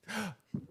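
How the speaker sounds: quiet high gasp; soft gasp. Retold or imitated. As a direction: give a small surprised gasp. Lady reacts in retold story.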